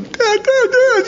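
rapid mock-terrified squeals for dad; panicked child. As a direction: imitate a panicking kid's very high squeal. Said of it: "he was just, was panicking".

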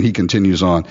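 usual speaking voice

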